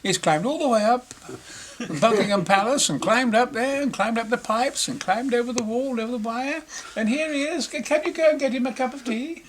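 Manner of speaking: high, posh-sounding mock Queen voice; mimicking the Queen. Imitating the Queen welcoming the intruder, high and gracious. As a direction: play the Queen, all gracious surprise.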